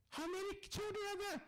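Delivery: high, astonished question; amazed. Retold or imitated. Teller asks his friend in amazement, high astonished voice. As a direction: gasp out the question in disbelief.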